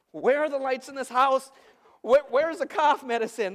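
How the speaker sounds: loud, high frantic demand; exasperated parent hunting through the house; panicked searching. Retold or imitated. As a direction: call out in a middle-of-the-night panic. Panicked half-asleep parent searching at night.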